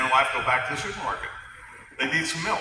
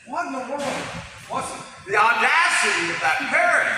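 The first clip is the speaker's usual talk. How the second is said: higher-pitched retold outrage; indignant wife; 7 semitones higher than usual. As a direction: play the wife, scandalized and offended. Wife's outrage at the parrot.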